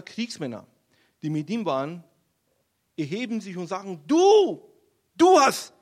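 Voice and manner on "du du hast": very loud, high-pitched outburst; accusing shout. Acted. David's men rising up against him, accusing shout.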